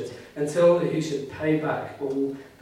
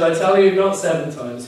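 louder and higher, ringing out the correction; emphatic declaration; 3 semitones higher, 9 dB louder than usual. Retold or imitated. Preacher voicing Jesus' firm correction to Peter, loud and emphatic. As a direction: proclaim it like Jesus overruling Peter.

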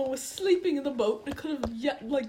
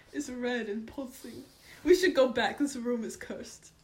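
ordinary delivery

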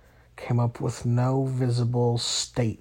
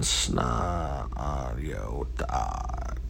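low, slow robot voice; 10 semitones lower, much slower than usual. Acted. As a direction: drone it out like a robot. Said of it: "trying my best to sound like a robot".

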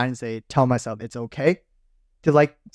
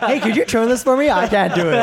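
high, louder, laughing helpless whine; mock helpless plea; 9 semitones higher, 4 dB louder than usual. Friend mocks him asking girlfriend for help, whining. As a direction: whimper for help in jest.

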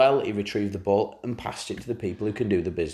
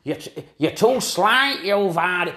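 jeering coach voice, higher and slow; mocking coaches; 7 semitones higher, 4 dB louder, slower than usual. Coaches' voices in his head taunting Vardy, jeering. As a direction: taunt him like a doubting coach. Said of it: "visions of them coaches going".

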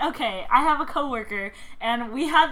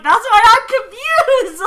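laughing retold reply; 7 semitones higher, 11 dB louder, slower than usual. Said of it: "And I'm like".

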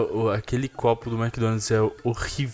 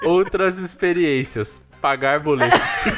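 laughing; 5 semitones higher, 5 dB louder, slower than usual. Real, not acted. Reading the review, laughing.

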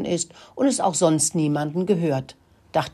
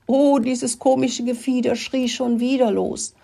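raised, higher storybook voice; mildly alarmed storytelling; 7 semitones higher than usual. A narrator's reading. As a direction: read it with higher-pitched dismay. Startled story line about the hen.

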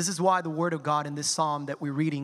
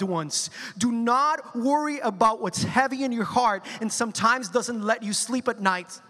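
rising preaching; 4 semitones higher than usual. Acted. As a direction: build each "do not worry" like a preacher lifting the room. Preaching exhortation to congregation.

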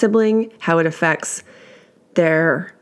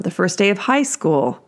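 ordinary talk, nothing marked

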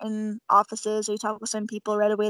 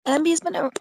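voice cracking; 6 semitones higher than usual. Real, not acted. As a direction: voice cracks mid-word. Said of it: "my voice is cracking".

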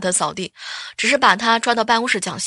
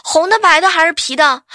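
loud, raised-pitch holler across the table; yelled question; 5 semitones higher, 7 dB louder than usual. Retold or imitated. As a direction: bellow it out like a rowdy buddy. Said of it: "他扯着嗓子就问". (扯着嗓子大声喊)

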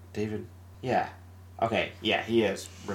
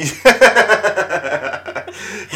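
higher-pitched burst of laughter; 7 semitones higher, 4 dB louder than usual. Real, not acted. Podcaster breaks up mid-quote, laughing. Cracking up while retelling the film.

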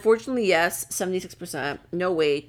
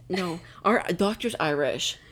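ordinary talk, nothing marked

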